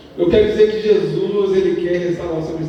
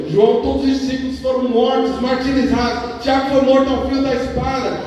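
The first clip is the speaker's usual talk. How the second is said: high, sustained pulpit delivery; impassioned preaching; 9 semitones higher than usual. Real, not acted. Preacher recounting the deaths of the disciples, impassioned preaching. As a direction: proclaim it at raised pitch.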